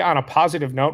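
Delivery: usual speaking voice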